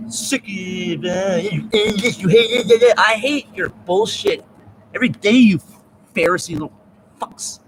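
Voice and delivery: high angry rant. Real, not acted. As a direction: spit it out at the haters. Ranting at Pharisees.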